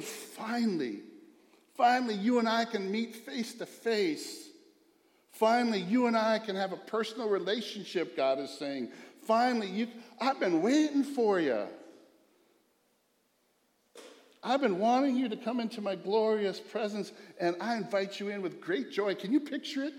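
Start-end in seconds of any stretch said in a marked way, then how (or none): 0.0-8.2 higher, louder, jubilant welcome; joyful God. Acted. God welcoming his child into his presence, overjoyed. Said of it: "he's gonna do it with joy". 9.2-19.2 higher, louder, jubilant welcome; joyful God. Acted. God welcoming his child into his presence, overjoyed. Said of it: "he's gonna do it with joy".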